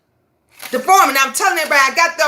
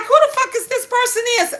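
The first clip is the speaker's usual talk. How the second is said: baffled disbelief; 8 semitones higher, much faster than usual. Retold or imitated. Confused by a stranger's call.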